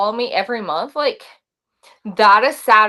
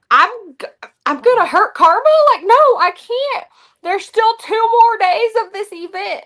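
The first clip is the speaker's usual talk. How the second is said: pleading higher and higher, near panic; panicked protest; 11 semitones higher than usual. Retold or imitated. Wife protesting to husband she can't wreck the truck, high and frantic. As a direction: voice climbing high in alarm. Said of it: "And I'm like".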